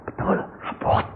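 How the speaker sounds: unvoiced whisper of astonishment; hushed wife. Wife finds the parcel really is heavy, hushed whisper. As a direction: whisper it, barely audible. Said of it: "dia bilang".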